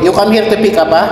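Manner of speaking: normal talking voice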